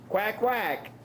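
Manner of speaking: duck quack. Shown as a demonstration. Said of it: "you know how to make a duck sound".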